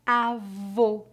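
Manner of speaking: avô with closed O, /o/ like "oh"; stressed closed ô at the end of avô. Shown as a demonstration. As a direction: say avô with lips rounded and the mouth nearly closed. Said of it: "Repita depois de mim".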